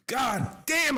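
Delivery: sudden high-pitched curse of frustration; exasperated outburst. Real, not acted. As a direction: spit it out in disgust. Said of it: "Sorry, y'all, I got mad there".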